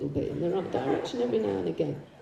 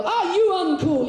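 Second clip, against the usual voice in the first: loud, high-pitched shout to a crowd; mock revivalist rallying cry; rallying call; 11 semitones higher, 5 dB louder, slower than usual. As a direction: call out to rouse the whole congregation.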